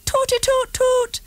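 high sing-song toot; tooting horn. A narrator's reading. Girl on bike tooting back, high and sing-song. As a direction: toot it like a toy trumpet. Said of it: "went Topsy".

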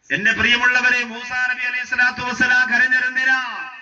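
intoned preaching with long-held line endings; impassioned religious storytelling; full-force sermon. Real, not acted. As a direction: preach it in a sustained, ringing chant. Preaches and exhorts the congregation.

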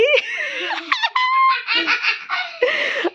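long, high-pitched laughing fit; helpless laughter. Real, not acted. Mother cracking up at her child's answer, long high laugh.

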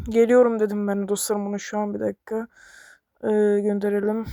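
ordinary talk, nothing marked

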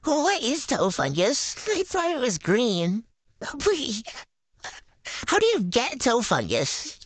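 ordinary talk

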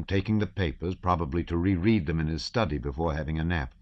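ordinary delivery, nothing marked

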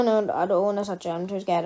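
ordinary delivery

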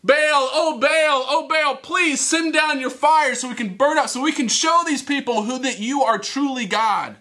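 hammed-up desperate calling; shouted plea. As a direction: wail out to a false god, drawing out the name. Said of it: "they begin to shout and yell".

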